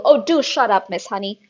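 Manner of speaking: very loud, fast contempt; scornful headmistress. A narrator's reading. Headmistress dismissing the teacher, very loud and fast. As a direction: cut her down with loud scorn.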